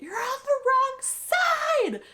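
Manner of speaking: high, slow mock yell; hollering a warning across the course; imitating sister. Sister warning her from the curb, high slow mock yell. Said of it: "she's like".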